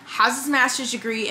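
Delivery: normal talking voice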